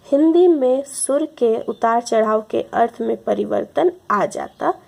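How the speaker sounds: ordinary talk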